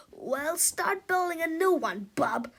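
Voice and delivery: loud, high, slow bossy bark; gruff shout. A narrator's reading. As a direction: order him around like a grumpy principal. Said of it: "shouted Cruppy the Kid".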